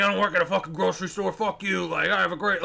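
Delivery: quick mock tantrum; mildly worked-up, rushed imitation; retold fit. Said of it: "this guy had a fit".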